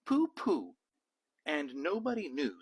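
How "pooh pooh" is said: pooh pooh. A narrator's reading. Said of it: "Madeline just said".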